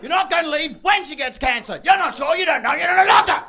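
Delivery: high, loud protest, speeding up; mock outraged wife. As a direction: throw the promise back at him, yelling. Acted wife throwing his promise back.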